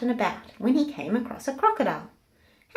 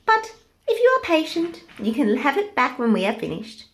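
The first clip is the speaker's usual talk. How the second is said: teasing monkeys; 6 semitones higher, 5 dB louder than usual. Acted. Monkeys tease the pig.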